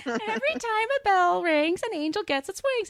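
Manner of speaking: put-on high, small child's voice; sweetly voicing a little girl; little Zuzu. Podcaster retelling little Zuzu's line to her daddy, in a high child's voice. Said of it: "little Zuzu says".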